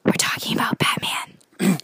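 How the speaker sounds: hushed reply; whisper. Pretend. Host whispering back to Caffeine Powered.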